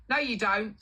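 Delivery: high, loud contradiction; exasperated mock scolding; indignant boss. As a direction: correct a cheeky worker, imitating the boss.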